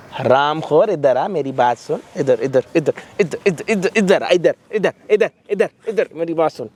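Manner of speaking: low, insistent repeated summons; menacing summons. Acted. Host summons the ungrateful son to listen, menacing.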